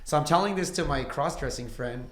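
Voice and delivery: ordinary talk, nothing marked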